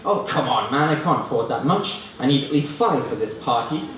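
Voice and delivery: normal talking voice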